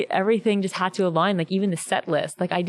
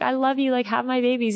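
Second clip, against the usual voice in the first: high, gushing fangirl plea; mock gushing fan; 6 semitones higher than usual. Fan mock-gushing at the singer, high and breathless. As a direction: overdo it like a lovestruck fan.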